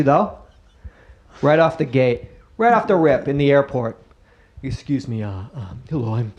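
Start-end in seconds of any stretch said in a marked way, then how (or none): 4.6-6.3 soft, hesitant introduction; low-key, halting, softened opener; timid polite approach. Acted. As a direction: politely interrupt a stranger, unsure of yourself. Acts himself politely approaching a local.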